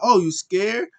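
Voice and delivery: high, loud goading question; mock taunt. Imitating Cedric goading the winners, mock taunting. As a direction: goad the rival into a fight.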